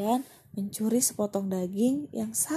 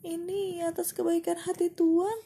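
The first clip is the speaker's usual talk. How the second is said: squeaky mouse; 10 semitones higher than usual. Acted. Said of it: "kata tikus".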